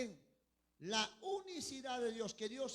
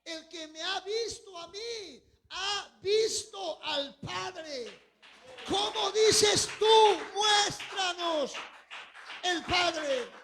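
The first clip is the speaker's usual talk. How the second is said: slow, loud, higher shouted rebuke; preacher's shout; 6 semitones higher, 13 dB louder, slower than usual. Acted. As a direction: thunder it out from the pulpit. Preacher voices Jesus' climactic rebuke.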